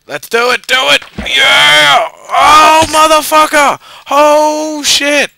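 loud, high hype turning to a cursing yell; real, loud gaming rage; excited yelling. Real, not acted. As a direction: psych yourself up, then swear at the miss. Risky jump, then failing it.

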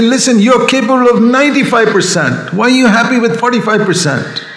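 a father raising his voice, not angry. Retold or imitated. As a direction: push the son, voice up. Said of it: "I may raise my voice and tell him".

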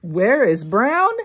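usual speaking voice

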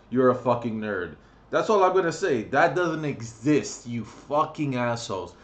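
ordinary talk

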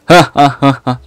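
hammed-up, evenly spaced ha-ha laugh; mock laugh. Game fisherman pleased with his new friend, mock laugh. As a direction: act out a game character's laugh.

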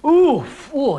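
a pleased little "ooh, ooh"; Fat Tulip's delight. A narrator's reading. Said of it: "he said".

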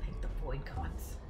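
low whisper, slowly; whispered mock prayer. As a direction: hush it like a prayer.

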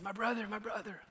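high, soft greeting from the tailor; tailor's greeting. Retold or imitated. Pastor quoting the tailor's greeting, high and soft. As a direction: gently echo the tailor's fond welcome. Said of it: "and he said".

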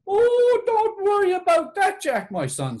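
high, crooning witch voice starting on a loud, drawn-out "Oh"; reassuring witch. A narrator's reading. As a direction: soothe Jack in a high witch's voice. Witch reassuring Jack in the tale.

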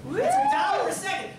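high shouted prize boast; excited hype. Real, not acted. Host hypes the prize money.

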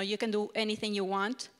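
ordinary talk, nothing marked

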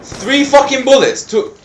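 loud, high-pitched complaint; exasperated yell. Real, not acted. Gamer complains about wasted bullets, exasperated yell. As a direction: shout it in disbelief at the count.

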